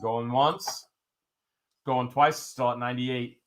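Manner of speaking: building countdown to a big call; auctioneer. Real, not acted. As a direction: count it down, then belt the sold.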